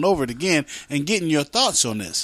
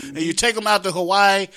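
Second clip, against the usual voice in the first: normal talking voice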